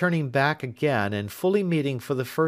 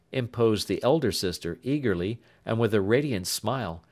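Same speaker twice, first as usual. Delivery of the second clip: ordinary delivery, nothing marked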